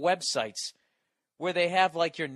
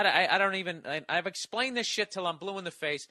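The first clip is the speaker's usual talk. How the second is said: ordinary talk, nothing marked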